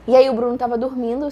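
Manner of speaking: normal talking voice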